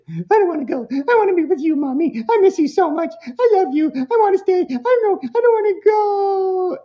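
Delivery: put-on high, whiny little-kid voice begging mommy; whiny pleading kid. Child begging mommy not to leave, high and whiny. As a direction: beg like a clingy child at drop-off. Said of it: "the kid is doing what kids do".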